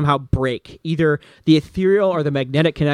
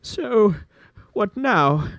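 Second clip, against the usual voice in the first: lightly higher, wondering; relieved villain; 6 semitones higher, much slower than usual. Acted. GM's Berzin catches his breath, a lightly voiced musing. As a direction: muse aloud after the danger passes. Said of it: "then says".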